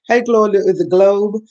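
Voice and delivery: normal talking voice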